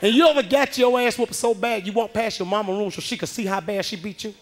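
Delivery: ordinary delivery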